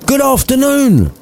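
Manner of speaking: sharp, high angry dismissal. Acted. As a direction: bark it out, fed up. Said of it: "much more pissed off".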